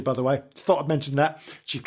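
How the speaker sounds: ordinary delivery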